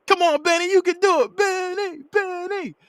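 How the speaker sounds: very high falsetto cheer; mock crowd yell, names stretched out; imitated sideline cheering. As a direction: cheer him on like family on the sidelines. Voices family cheering from sidelines.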